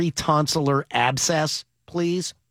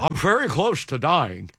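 stuffed-mouth anchorman impression; Tom Brokaw; 2 semitones lower than usual. Host plays the ailing anchor for laughs, Brokaw voice. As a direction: answer in the mumbling newsman voice. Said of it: "hot potato voice is my Tom Brokaw".

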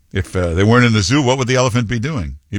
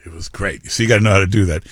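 ordinary talk, nothing marked

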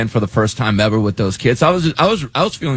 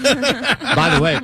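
laughing; 14 semitones higher, much slower than usual. Real, not acted. Laughing after the vocal rest story.

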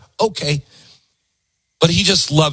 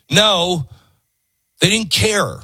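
ordinary delivery

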